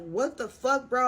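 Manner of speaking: half-hearted retold shout of disbelief; retold yelling. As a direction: imitate your own indignant yelling, held back. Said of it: "I'm, like, yelling, like".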